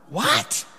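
high, startled What; mock surprise. Preacher reacting to the call to hate family, mock shock. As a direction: pop it up high in disbelief. Reacting to Jesus' hard words.

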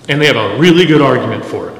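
ordinary delivery

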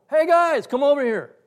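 loud, high call across the street. Retold or imitated. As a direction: shout for the team to come over. Calling the team across street.

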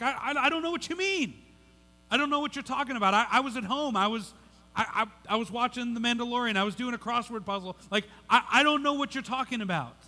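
high, loud, stammering excuses; flustered Peter protesting his innocence; panicked denial. Acted. Acted Peter denying Jesus, flustered.

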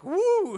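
high, loud whooping cheer; hammed-up celebration whoop; mock cheer. TV medical team celebrating a save with a loud whoop. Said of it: "it's like".

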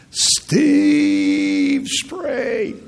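high, loud hollered name. Retold or imitated. Pastor hollers his friend's name on the coaster, high loud shout. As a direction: yell it down the roller coaster drop. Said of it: "I would holler".

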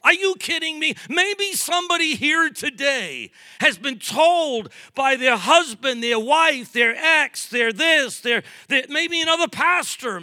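high, loud pulpit delivery; full-force preaching. Real, not acted. Preacher to somebody written off by others, full-force preaching.

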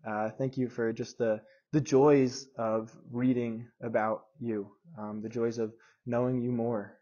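prayer. Real, not acted. Pastor praying over the kids, soft and reverent. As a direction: hush and pray quietly for the group.

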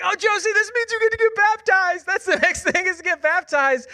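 high, loud, gushing imitation of kids; excited boys. Brothers tell little sister about baptism, excitedly. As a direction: burst out like thrilled brothers, laughing a little. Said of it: "the boys were more excited".

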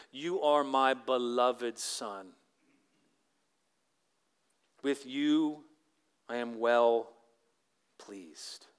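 slow and tender, fading to a whisper on pleased; hushed reverent reading. Acted. Pastor reading God's voice to the congregation, slow and hushed. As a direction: let God's words settle, slowly, almost breathed. God's words read from the Bible.

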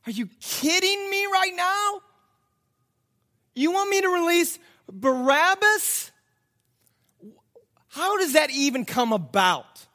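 high-pitched, loud disbelief; Pilate's incredulous outburst. Acted. Pilate questions the crowd's choice, high incredulous outburst. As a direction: squeal the questions in astonishment. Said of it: "imagine Pilate right here thinking".

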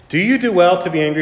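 amused quick question; laughing. Shown as a demonstration. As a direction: repeat God's question with a laugh.

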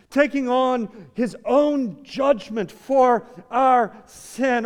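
emphatic sermon climax, punching key words; impassioned preaching. Real, not acted. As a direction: proclaim the gospel with full fervour. Preaches at full force.

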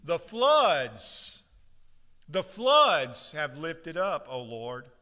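loud, slow scripture reading leaning on FLOODS. Shown as a demonstration. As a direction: swell hard on FLOODS. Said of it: "I'm gonna raise my voice a bit".

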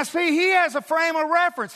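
ordinary delivery

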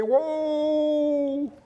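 a long high whoa, as to a horse; high drawn-out whoa. Pretend. Intern showing the horse command, high drawn whoa.

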